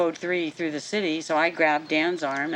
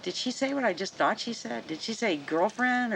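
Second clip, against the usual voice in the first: higher-pitched imitation of baffled inner thoughts; mock puzzlement, pitched up; puzzled partner's thoughts; 7 semitones higher than usual. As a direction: voice someone silently double-checking what they heard. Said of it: "his mind was clicking like".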